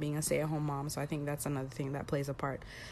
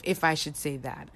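normal talking voice

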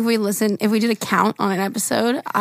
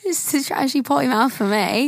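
put-on slurred British reality-star accent, raspy; British accent like Chloe; 3 semitones higher than usual. Copying Chloe from Love Island in a British accent. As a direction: drag the word ends like a British TV girl. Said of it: "I feel like Chloe from Love Island U K".

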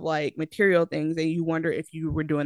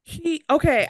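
usual speaking voice

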